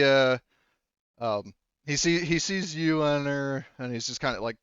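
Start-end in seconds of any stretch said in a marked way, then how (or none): none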